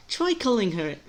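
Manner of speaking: put-on high British-accented character voice; British accent, high opening; too-British voice. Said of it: "it's sounding too British".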